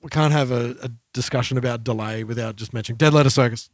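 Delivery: ordinary delivery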